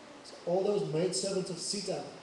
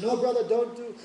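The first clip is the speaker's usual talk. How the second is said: Ram protesting; 7 semitones higher, 8 dB louder than usual. Acted. Ram stops brother touching feet.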